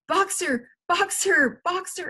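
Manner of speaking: high, repeated desperate calls; rising desperation in a high cry; cried out. A narrator's reading. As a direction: call the name urgently, pitched high. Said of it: "she cried".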